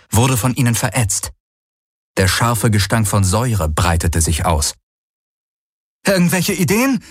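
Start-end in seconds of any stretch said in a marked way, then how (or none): 6.1-7.0 high, anxious called question; calling out. Acted. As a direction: shout the question while running. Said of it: "rief Kirai".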